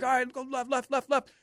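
high, urgent calls to steer left; relaying his partner's steering calls mid-air; Art's instructions. Retold or imitated. Art calling go left during the jump, high and urgent. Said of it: "And Art's like".

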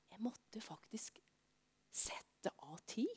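slow whispered confession. Real, not acted. Preacher confiding to children, whispered slowly. Sermon point dropped to hush.

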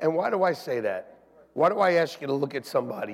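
ordinary delivery

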